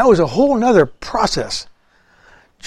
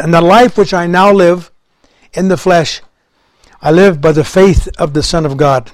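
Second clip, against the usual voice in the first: forceful preaching; 5 dB louder than usual. Acted. Preacher quoting scripture forcefully.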